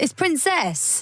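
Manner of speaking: high, stretched-out correction; slow, high insistence on the right word; exasperated correction. Real, not acted. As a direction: lift the pitch and draw it out. Co-host corrects the slip.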